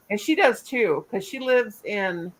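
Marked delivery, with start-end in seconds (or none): none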